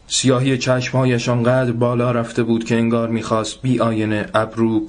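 ordinary delivery, nothing marked

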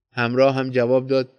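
ordinary delivery, nothing marked

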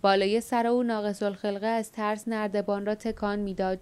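ordinary delivery

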